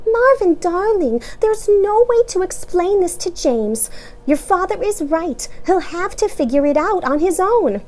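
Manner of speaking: high, gentle mother; gently firm mom. A narrator's reading. Mama explains to child.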